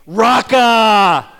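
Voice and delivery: loud, sharp Aramaic "Raka!"; horn-blast insult. Retold or imitated. Pastor voices his horn blast as the Aramaic insult "Raka!", loud. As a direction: bark out "Raka!" like a car horn. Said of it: "it's basically like saying".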